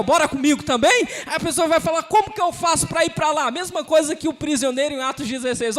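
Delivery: fired-up evangelistic shout; full-force preaching. Acted. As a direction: preach it out at the top of your voice.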